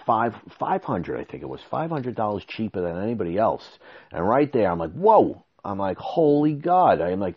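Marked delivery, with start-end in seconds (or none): 4.9-5.4 a jolted, high, louder whoa; surprised whoa. Retold or imitated. Amazed by the concrete guy's price, high surprised whoa. 6.0-7.0 high, slow amazed exclamation. Retold or imitated. Hearing the price, slow high amazed outburst.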